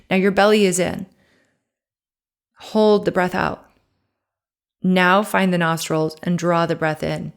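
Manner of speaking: calm, unhurried breathing cues; guided breathing. Acted. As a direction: guide the listener through each breath. Leads a breathing exercise.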